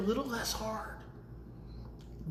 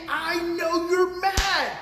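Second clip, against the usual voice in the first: mock loud soothing parent; 8 semitones higher, 8 dB louder than usual. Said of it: "if you were instead saying".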